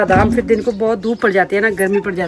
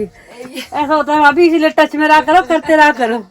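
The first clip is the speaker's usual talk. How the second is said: laughing tease; 5 semitones higher than usual. Real, not acted. Teasing sister-in-law who is out of breath.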